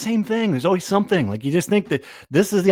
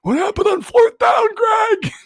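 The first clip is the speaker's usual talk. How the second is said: put-on much higher old-man voice, shouting and cheering; 5 dB louder than usual. Old fan yelling back to coach Greg at the presser, hammed-up old man shout. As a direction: holler at the coach like a hard-of-hearing old fan. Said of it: "Let's do the entire podcast like this".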